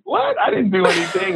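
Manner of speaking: high, indignant denial; defensive protest. Retold or imitated. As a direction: protest your innocence, offended. Sister protests innocence, acted quote.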